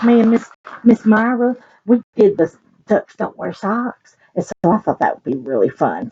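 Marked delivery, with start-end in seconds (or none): none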